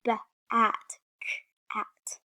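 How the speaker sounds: "b-at, k-at" with the first sound split off; "b-at, k-at" in separated sounds, teacher demo. Shown as a demonstration. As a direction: break each word after its first consonant, "b-at", "k-at".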